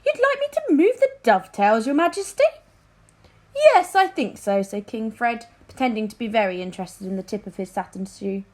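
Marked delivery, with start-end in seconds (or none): 0.0-2.4 adviser. A narrator's reading. Narrator voices the chief adviser. 3.5-4.6 king. A narrator's reading. King answers his adviser.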